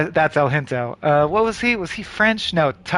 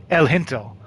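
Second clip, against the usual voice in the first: ordinary delivery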